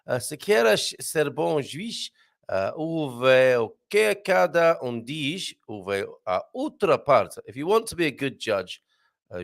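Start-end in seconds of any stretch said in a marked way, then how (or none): 0.2-7.4 Portuguese. Shown as a demonstration. Reads Portuguese proverb slowly.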